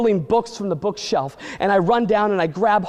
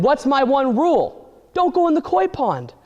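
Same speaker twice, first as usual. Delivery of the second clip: scolding mom; 7 semitones higher than usual. Retold or imitated. Mom scolding sister in koi pond.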